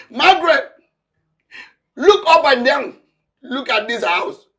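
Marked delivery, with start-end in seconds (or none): 0.1-0.7 loud call. Acted. Calls her name again, higher. 2.0-2.9 angry order. Acted. Ordering wife angrily.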